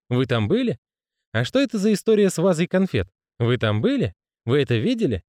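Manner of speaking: lightly voiced curious gossips; a hint of nosy townsfolk; eager gossip.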